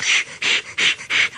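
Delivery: whispered shh puffing rhythm; soft unvoiced chuffing; engine puffs. A narrator's reading. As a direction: puff like a steam engine.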